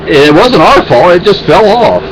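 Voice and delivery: ordinary talk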